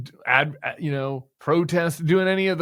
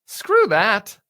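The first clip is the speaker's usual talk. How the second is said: loud, higher, disgusted; angry dismissal; 7 semitones higher, 8 dB louder than usual. Real, not acted. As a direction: spit it out in disgust.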